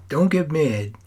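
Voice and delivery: plain reading voice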